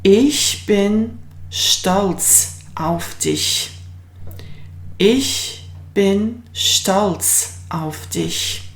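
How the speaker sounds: very slow clear German sentence; slow model sentence. Shown as a demonstration. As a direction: model it slowly for the class. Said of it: "We'll say".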